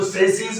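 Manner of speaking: सेसीज said "SAS-eez"; plural pronunciation. Shown as a demonstration. Teacher showing the plural to students, pronounced "sas-eez". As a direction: say सेसीज with a final z or j sound. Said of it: "जब plural की बात करेंगे".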